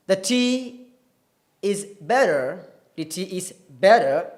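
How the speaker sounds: American demo of "better" with R at the end; American accent. Shown as a demonstration. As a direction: say "better" the General American way, T turned to a tap. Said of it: "that is typical American".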